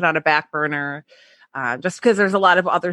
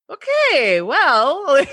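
higher, drawn-out awkward okay well; 7 semitones higher, 3 dB louder, much slower than usual. Retold or imitated. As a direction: break the silence awkwardly, pitched up. Awkward end of interview silence.

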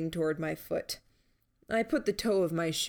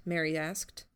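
usual speaking voice

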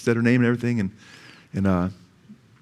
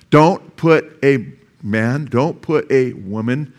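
slow emphatic warning; slower than usual. Real, not acted. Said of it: "before Jesus".

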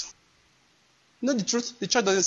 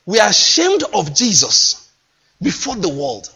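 fervent preaching. Real, not acted. Preaching at full force.

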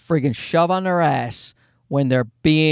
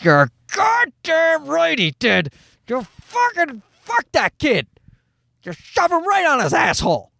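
inner gloating; 11 semitones higher than usual. Retold or imitated. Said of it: "Now, inside, I'm like".

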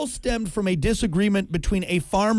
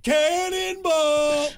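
cannonball yell; 10 semitones higher, much slower than usual. Retold or imitated. Repeating the jump call.

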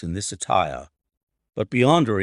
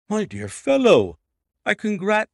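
higher, louder burst of surprise; eager congratulations cut short mid-word; startled congratulation; 10 semitones higher, 6 dB louder than usual. Acted. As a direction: Watson caught off guard, bursting out. Watson startled by engagement news.

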